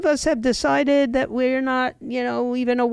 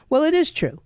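ordinary delivery

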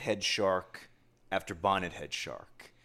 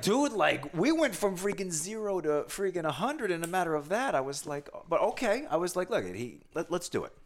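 incredulous; 9 semitones higher than usual. Retold or imitated. Telling the host how the guide raised the bar, incredulous. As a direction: blurt it out overwhelmed. Retold nervous disbelief, loud high.

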